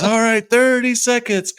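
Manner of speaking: lightly voiced MC countdown; imitating the announcer. The town MC announcing the countdown, mock announcer.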